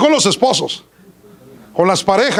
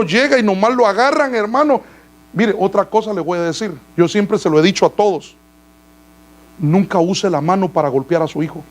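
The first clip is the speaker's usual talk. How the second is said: normal talking voice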